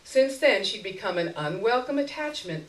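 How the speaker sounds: plain reading voice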